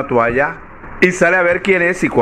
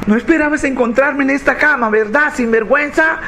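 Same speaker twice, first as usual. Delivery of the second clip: agitated wife; 11 semitones higher than usual. Acted. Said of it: "la mujer muy agitada".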